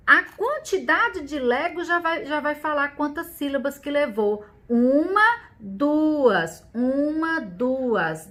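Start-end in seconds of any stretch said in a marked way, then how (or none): 4.7-8.3 counting syllables aloud. Shown as a demonstration. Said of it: "Quantas sílabas levou?".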